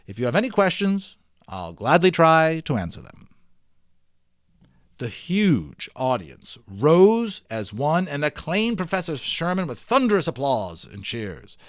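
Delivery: usual speaking voice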